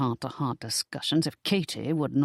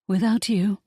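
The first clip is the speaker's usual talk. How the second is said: high, louder frightened gasp; gasped in alarm; 6 semitones higher, 4 dB louder than usual. A narrator's reading. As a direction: catch your breath in sudden fear. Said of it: "she gasped".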